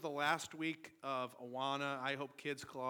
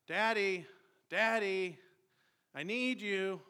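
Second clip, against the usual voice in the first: a lightly voiced little boy calling out, a bit louder; child calling; 5 semitones higher, 6 dB louder, slower than usual. Young son calling for his daddy from bed, lightly voiced child. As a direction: call down the stairs for daddy like a small son.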